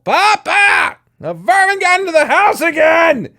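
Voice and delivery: high, loud call downstairs; yelling down the hall. Acted. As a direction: shout to Papa across the house. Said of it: "Cole yelled down the hall".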